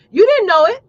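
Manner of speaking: proud exclaim. Real, not acted. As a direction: crow about it. Proud childhood boast.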